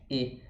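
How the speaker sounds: low, soft closed "E", unrounded; plain spread-lipped "E"; Portuguese E. Shown as a demonstration. Teacher confirming the Portuguese vowel, spread E. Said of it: "É o E que a gente tem em português".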